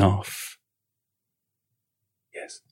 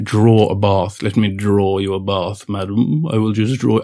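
mocking posh butler. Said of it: "Some lady from the fifties".

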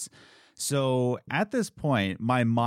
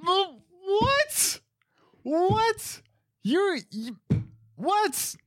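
very high incredulous what; mock stunned repeated what; disbelief; much slower than usual. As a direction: squeak out shocked disbelief. Disbelief at decaf order.

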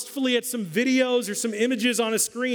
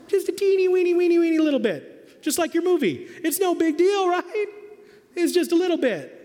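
much higher, louder sing-song coaxing; coaxing mom; 11 semitones higher, 4 dB louder than usual. Retold or imitated. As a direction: sweet-talk him into the brownie. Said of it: "she said".

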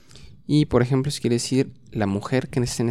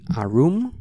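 model phrase; 4 semitones higher than usual. Shown as a demonstration. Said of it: "Repeat after me".

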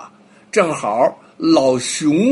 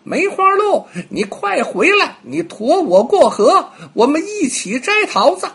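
very high, louder call across the river; monkey calling out; 10 semitones higher, 4 dB louder than usual. Acted. Monkey stuck at the river calls to the deer, high and loud. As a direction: shout over the water to bring him back. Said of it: "就喊了起来".

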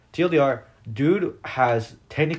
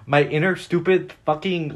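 ordinary delivery

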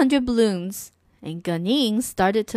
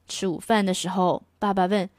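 ordinary delivery

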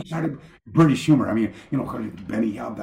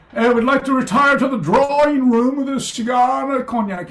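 higher, grand drawing-room voice; mock posh host; 12 semitones higher, 5 dB louder than usual. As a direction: play the lord of the manor inviting guests. Mock grand after-dinner invitation.

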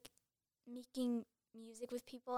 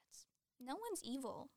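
whispered; 2 semitones higher, 9 dB quieter than usual. Retold or imitated. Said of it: "'Cause I'm like".

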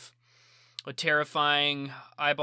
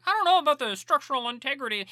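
mock nitpicker; 11 semitones higher, 4 dB louder than usual. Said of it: "and you're like".

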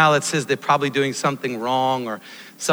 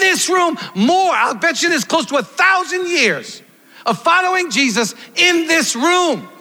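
full-force preaching; 12 semitones higher than usual. Acted. Pastor preaching to his church at full force. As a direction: pound it home to the congregation.

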